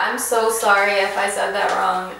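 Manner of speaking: normal talking voice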